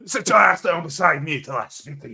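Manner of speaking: put-on American wrestler accent, growled; a hint of a wrestler promo; Hulk Hogan impression. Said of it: "That's the only American I can do".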